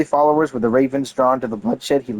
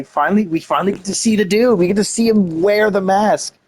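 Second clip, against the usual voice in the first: finally seeing him, thrilled; excited; 8 semitones higher, 3 dB louder than usual. Real, not acted. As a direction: pitch jumps higher with eager delight. Excited to finally see character.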